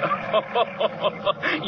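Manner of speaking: short amused laugh; hearty laugh. Acted. Driver laughs at sleep talk.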